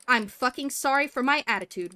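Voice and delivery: loud sarcastic yell. Retold or imitated. Yelling back at EMS over the radio, sarcastic and loud. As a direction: shout the apology, not meaning it. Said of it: "I just yelled".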